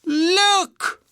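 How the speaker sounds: very high little bear call; Baby Bear's cry. A narrator's reading. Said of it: "cried Baby Bear".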